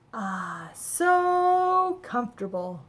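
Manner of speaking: slow, drawn-out and cozy; blissful and slow; contented sigh. A narrator's reading. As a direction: melt into the chair. Settling into the little chair.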